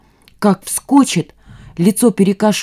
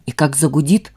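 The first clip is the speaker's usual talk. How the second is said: usual speaking voice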